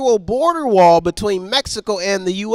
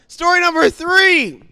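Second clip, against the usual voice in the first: announcer; 8 semitones higher, 5 dB louder than usual. Acted. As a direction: announce it like a game show host. Host announces story.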